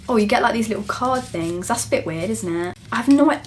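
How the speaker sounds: normal talking voice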